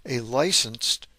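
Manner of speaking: normal talking voice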